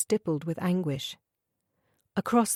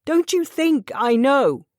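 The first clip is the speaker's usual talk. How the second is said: 1 emotional outburst; 8 semitones higher, 8 dB louder, slower than usual. A narrator's reading. Mac's emotional outburst to Sophia.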